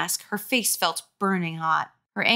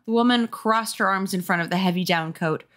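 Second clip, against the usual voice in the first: ordinary delivery, nothing marked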